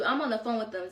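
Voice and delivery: ordinary talk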